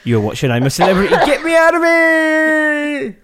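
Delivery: high, long-drawn catchphrase; exaggerated show intro, stretched out at a high pitch; mock TV announcer. Podcaster parodying a reality show intro, mock announcer.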